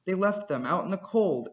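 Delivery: usual speaking voice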